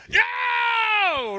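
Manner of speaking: high, loud celebratory yell; fan's yell. Retold or imitated. Fan cheering a bracket upset, loud and high. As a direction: shout like your team just won. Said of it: "what do you do?".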